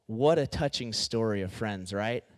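low, dry sarcastic aside; deadpan sarcasm. Real, not acted. Preacher mocking the harsh passage as touching, deadpan sarcasm.

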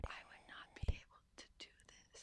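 hushed ASMR whisper; voiceless, playful aside. Pretend. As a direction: whisper it close to the mic.

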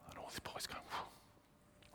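breathy whispered whew of alarm; a soft unvoiced whistle of shock; whistled whew. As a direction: boys whistling under their breath at the news. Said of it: "like that".

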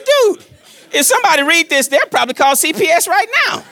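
high, loud and laughing disbelief; half-laughing mock panic; comic alarm. Retelling his alarmed reply to son.

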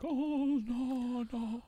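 very high, drawn-out mock wail; wailing oracle. Imitating the film's oracle at his instrument, high mock wail. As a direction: sustain it high like a hammed-up lament. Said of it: "He's just like".

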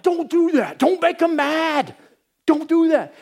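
high, slow, pleading; panicked plea. Retold or imitated. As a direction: beg him to stop, half laughing in alarm. Pleading as developer calls owner.

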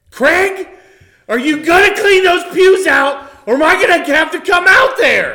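yelling across the church; shouting. Acted. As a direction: call out loud and annoyed. Priest yells to janitor outside.

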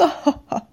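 a bright, loud burst of laughter. Acted. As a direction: laugh loudly at your own joke. Laughs at own joke.

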